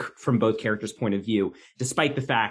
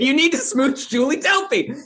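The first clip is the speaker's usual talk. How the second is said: high, loud and laughing; 13 semitones higher, 4 dB louder than usual. Real, not acted. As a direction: laugh through the line. Joking reply about Julie Delpy.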